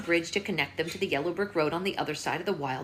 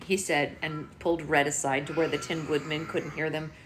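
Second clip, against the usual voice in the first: plain reading voice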